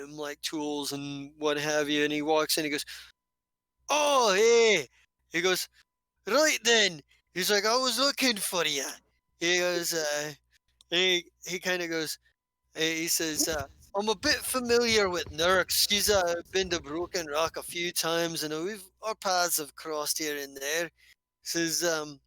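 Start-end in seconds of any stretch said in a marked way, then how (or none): 3.9-8.8 boisterous dwarf, higher and louder. Acted. GM voices gruff dwarf arriving. 14.0-20.9 lightly voiced hearty dwarf; friendly dwarf easing into the chat. GM plays dwarf Kolnak.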